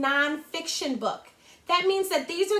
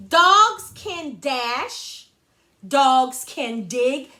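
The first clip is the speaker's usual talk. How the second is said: slow read-aloud; 5 dB louder, much slower than usual. Shown as a demonstration. Teacher reading slowly aloud.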